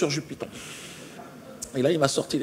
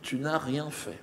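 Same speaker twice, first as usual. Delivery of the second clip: soothing alien; 10 dB quieter, slower than usual. Retold or imitated. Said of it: "l'extraterrestre lui dit".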